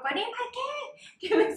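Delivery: high, slow, pleading cry; desperate plea. Retold or imitated. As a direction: beg for the key, stretched and high. Retold plea for her key.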